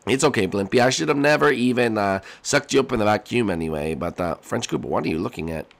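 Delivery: ordinary talk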